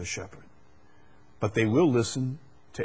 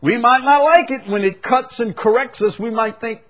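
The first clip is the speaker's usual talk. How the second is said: fiery preaching; 12 semitones higher, 9 dB louder than usual. Acted. Preaching at full force.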